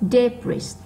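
DE in "debris" punched; stressed first syllable. Shown as a demonstration. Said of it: "nó to hơn những âm còn lại". (nhấn mạnh âm một của "debris")

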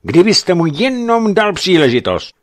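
heated insistence; insistent protest. A narrator's reading. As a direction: keep pressing the point. Lockhart keeps arguing.

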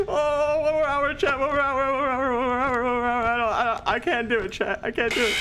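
high, tense, panicky chant; panicked pleading. Real, not acted. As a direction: beg through the panic. Panicked pleading during game.

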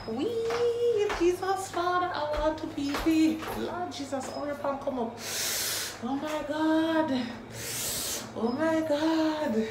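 high, desperate pleading; needing the toilet. Real, not acted. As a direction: beg for relief, squirming. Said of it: "I'm about to wet myself".

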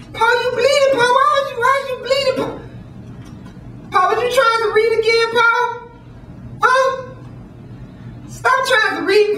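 put-on very high child voice pleading with his father; son's voice. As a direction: whine and beg like a frightened little boy. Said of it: "his son woke up and said".

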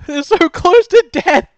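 high, loud, laughing and slowed; laughing shriek. Real, not acted. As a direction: shriek the words through laughter. Nearly winning the fight.

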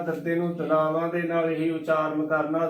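normal talking voice

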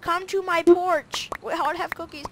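high, silly put-on voice; playful cookie fanatic. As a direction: proclaim cookies in a goofy high pitch, squealing at the end. Plays cookie giver.

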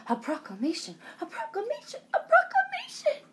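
building from plain to high laughing squeal; panicked excitement. Acted. Wilbur panics, voice climbing.

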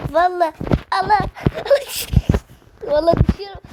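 high, loud panicked laughing yell; screaming while falling. Real, not acted. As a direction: shriek it, slipping off the edge. Gamer panics about falling.

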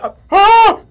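sudden high, loud yelp; real shriek of surprise; startled scream. Real, not acted. Player creeping forward gets startled, yelping in fright.

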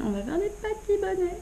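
squeaky inner-voice idea; inner thought voice. Retold or imitated. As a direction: pop the idea out high and bright like a sudden plan. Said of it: "je me suis dit".

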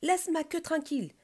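high, loud scolding; indignant shout. A narrator's reading. Squirrel whose tail was grabbed, indignant shout. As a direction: tell them off loudly and high. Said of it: "cria l'écureuil".

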